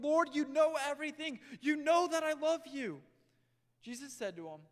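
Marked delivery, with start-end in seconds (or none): 0.0-3.0 loud, higher pleading voice; pleading Peter. Acted. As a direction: Peter insisting to Jesus, voice raised.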